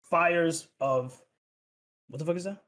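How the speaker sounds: ordinary delivery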